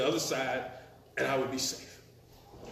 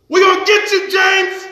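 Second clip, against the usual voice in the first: high, loud threatening yell; 13 semitones higher, 13 dB louder than usual. Retold or imitated. Thugs chasing James yell out of the car, loud threatening shout. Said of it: "someone yells".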